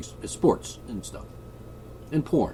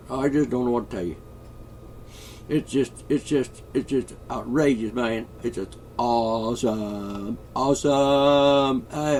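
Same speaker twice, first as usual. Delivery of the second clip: slow, stretched-out hillbilly drawl; hillbilly accent; 2 semitones higher than usual. Pretend. Mock hillbilly asking to bring pot to the palace, drawling. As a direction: put on a hillbilly twang and drag out the vowels. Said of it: "You know, hillbilly".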